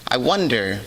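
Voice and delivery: ordinary talk, nothing marked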